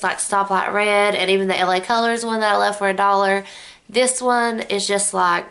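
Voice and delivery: normal talking voice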